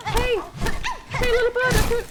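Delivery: high, loud call to a bird. Real, not acted. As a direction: sing out to the little bird. Calling to a game bird.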